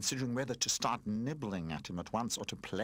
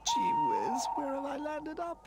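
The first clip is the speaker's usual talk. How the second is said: sobbing toy; 4 dB louder than usual. Acted. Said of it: "he sobbed".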